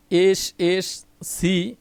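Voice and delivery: এসএসসি said slowly, letter by letter; drawn-out letters. Shown as a demonstration. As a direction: stretch out each letter of এসএসসি. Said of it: "এই". (ধীরে টেনে এসএসসি)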